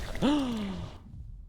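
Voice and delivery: sharp breathy gasp of dismay; a soft shocked intake of breath. Real, not acted. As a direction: gasp as the plan fails. Companion dies unexpectedly.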